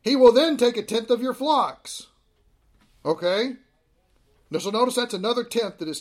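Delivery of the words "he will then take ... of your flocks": emphatic reading. Real, not acted. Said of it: "It says here".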